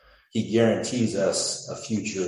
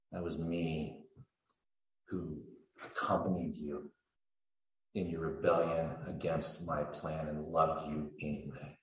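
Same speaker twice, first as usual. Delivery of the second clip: tender hush; 7 dB quieter than usual. Acted. Soft tender closing repetition.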